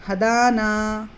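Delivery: हदाना slowly, both आ held two counts; stretched vowels. Shown as a demonstration. Teacher showing students the double stretch in हदाना. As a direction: draw out both long आ in हदाना. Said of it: "दोनों को दो दो हरकत खींचेंगे".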